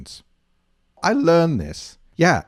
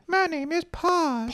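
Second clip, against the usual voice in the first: kitten voice. Pretend. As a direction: squeak it out like a tiny kitten. Kitten answers its name.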